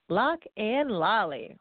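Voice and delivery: slow, relished LOCK AND LOLLY, each word apart; savored, drawn-out name. Shown as a demonstration. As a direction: stretch out Lock and Lolly for fun. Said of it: "I just like saying that name".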